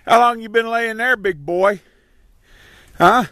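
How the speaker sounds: high sing-song reassurance to an animal; soothing animal talk. Real, not acted. Talking to fallen horse.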